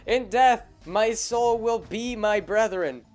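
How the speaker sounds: loud, slow, high dramatic dying hero; overacted death scene. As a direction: ham up a heroic last breath. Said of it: "he drops his sword and he goes".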